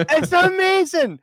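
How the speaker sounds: high, slow drawn-out rave; excited repeat. Real, not acted. Host repeating his rave to his co-host, high slow drawn-out. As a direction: stretch the word in delight again.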